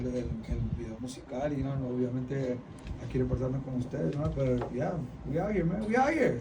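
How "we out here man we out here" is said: hyped. Real, not acted. Hyping up the crew.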